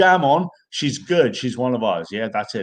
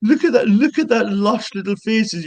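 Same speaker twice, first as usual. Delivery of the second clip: much higher and louder, slowing on the praise; delighted gush; 10 semitones higher, 4 dB louder than usual. Real, not acted. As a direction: gush over the smiling face like it's adorable. Gushing at a smiling face.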